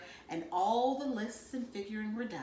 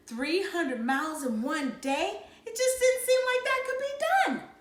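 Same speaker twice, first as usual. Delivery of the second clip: amazed disbelief; 10 semitones higher, 4 dB louder than usual. A narrator's reading. Kids amazed by distance.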